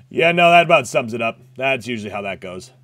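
ordinary talk

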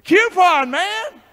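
high and loud "Coupon" in delight; excited exclamation. Real, not acted. Preacher exclaiming his delight over coupons. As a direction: cry out with glee.

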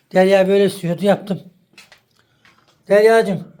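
loud call across the house, rising at the end; husband loudly calling his wife to come and see; calling out to Derya. Real, not acted. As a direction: call her from the next room.

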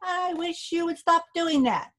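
a hint of a tiny fairy man; little voice. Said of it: "he heard a little voice".

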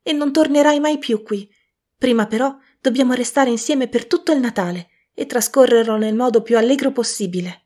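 voce di bambina più alta e più forte, piena di gioia; bambinetta gioiosa. A narrator's reading. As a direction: una sorellina felice che corre dal fratello. La bambina Fan parla. (bambina entusiasta)

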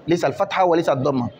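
normal talking voice

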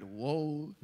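slow, drawn-out mock wail; a hint of a whiny crybaby; mock lament. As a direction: moan it like a self-pitying crybaby. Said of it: "he always cried".

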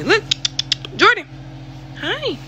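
very high singsong-like dog greeting; high pet-calling. Real, not acted. Owner calling the dog to look, high pet voice. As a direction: get the dog's attention in a squeaky-high voice. Getting the dog's attention.

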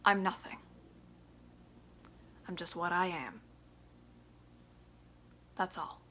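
soft, drained, resigned; defeated. Acted. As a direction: let it all go, broken. Biff spent, resigned ending.

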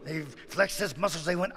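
ordinary delivery, nothing marked

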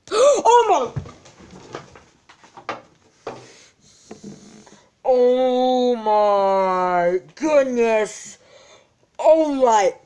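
high, loud, stretched-out gasps of awe; ecstatic squeal. Real, not acted. Pulling a rare Full Art.

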